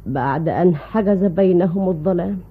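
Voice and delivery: normal talking voice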